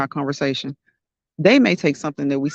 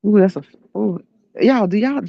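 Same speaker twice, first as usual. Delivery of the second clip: talking through a face mask; muffled voice behind a mask; 4 semitones higher than usual. Real, not acted. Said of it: "I'm talking through my mask".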